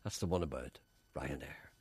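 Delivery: ordinary talk, nothing marked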